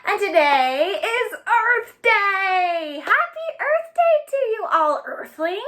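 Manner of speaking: a big excited Earth Day welcome; excited greeting. Real, not acted. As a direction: greet them like it's a party. Opening Earth Day greeting to kids.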